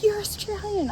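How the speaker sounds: put-on drunk guy. Smashed guy approaching a solo traveler, high mock drunk-guy voice. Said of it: "he was so smashed".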